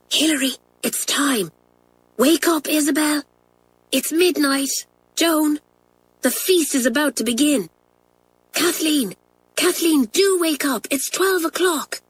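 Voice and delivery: put-on high, hushed girlish voice; hushed wake-up call. A narrator's reading. Pat waking each girl at midnight, high and hushed. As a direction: coax sleepers awake at midnight. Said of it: "whispering in her ear".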